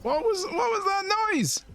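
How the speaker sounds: very high, alarmed repeat of the question; jolted by the noise, ask again; shrill startled. Real, not acted. Player startled by a noise in the game, alarmed high voice.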